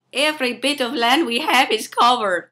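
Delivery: very high squeaky laughing voice; squeaky chipmunk voice. Pretend.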